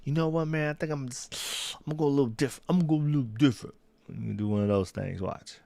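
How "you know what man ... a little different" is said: lightly voiced buddy changing his pick; imitating Elijah. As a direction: friend imitation, higher and casual. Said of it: "He might do his whole, like".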